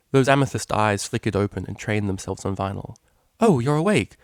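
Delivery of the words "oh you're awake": high, loud surprised exclamation; startled. A narrator's reading. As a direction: caught out mid-practice. Octavia startled, apologetic.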